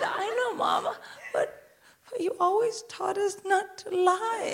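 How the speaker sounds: put-on high, soft child voice, halting; little daughter. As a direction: plead with mum like a small child. Voices daughter pleading to mother.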